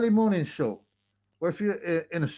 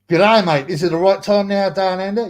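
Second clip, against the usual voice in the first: mock Australian greeting; 4 semitones higher, 8 dB louder than usual. Greeting Australian viewers loudly.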